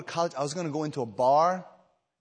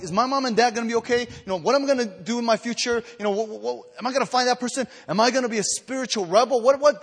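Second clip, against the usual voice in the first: retold anxious prayer; 8 semitones higher, 5 dB louder than usual. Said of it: "there was, like".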